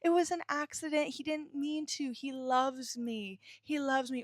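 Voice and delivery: high, soft, wide-eyed excuse-making voice; mock naive victim. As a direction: parody a victim defending her abuser. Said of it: "giving them the excuse of".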